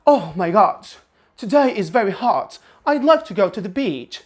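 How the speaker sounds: sing-song British intonation; lilting British tune with wide swoops; British melody. Shown as a demonstration. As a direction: speak as if singing, British-style. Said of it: "they have this kind of melody".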